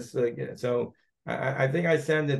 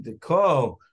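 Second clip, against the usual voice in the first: "call" hit hard and louder; stress on call; 3 dB louder than usual. Shown as a demonstration. Rabbi showing a student where the stress goes, "call" punched. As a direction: lean into the word "call". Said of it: "put a big emphasis on that word call".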